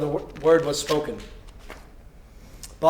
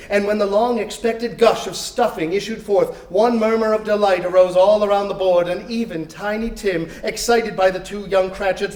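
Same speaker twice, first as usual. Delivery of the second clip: ordinary delivery, nothing marked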